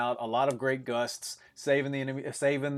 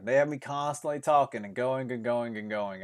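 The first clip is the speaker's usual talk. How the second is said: usual speaking voice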